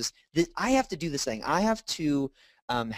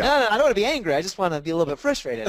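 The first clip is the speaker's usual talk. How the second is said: higher, louder protest that trails off faster; retold pushback to the counselor, higher and louder; mock protest; 6 semitones higher, 4 dB louder, faster than usual. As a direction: bristle at being called angry, half-joking.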